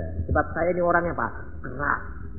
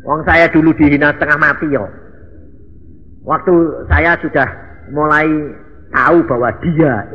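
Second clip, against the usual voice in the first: loud preaching; 2 semitones higher, 8 dB louder than usual. Real, not acted. Preaching loudly.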